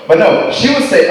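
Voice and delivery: usual speaking voice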